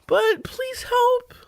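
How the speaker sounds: very high, crying plea; tearful cat lady plea. Acted. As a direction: beg for help in a squeak. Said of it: "I am a crazy cat lady".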